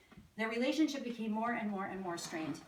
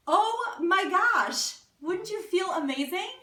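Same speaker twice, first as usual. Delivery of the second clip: slow, loud, high "Oh my gosh" then a thrilled question; delighted gush; 9 semitones higher, 10 dB louder, slower than usual. Acted. Preacher imagining a spouse's loving question, delighted and loud. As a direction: gush at how wonderful it would feel.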